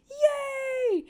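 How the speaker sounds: drawn-out high shout of joy; yay. Real, not acted. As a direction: cheer it out slowly, very high and loud. Excited anime recap cheer.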